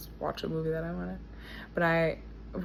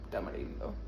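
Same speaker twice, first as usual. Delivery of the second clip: whispering; 11 semitones lower, 9 dB quieter, faster than usual. Real, not acted. Repeated place name, softly.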